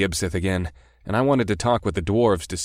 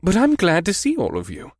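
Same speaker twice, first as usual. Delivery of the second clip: embarrassed grin; 10 semitones higher than usual. Acted. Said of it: "with an embarrassed grin".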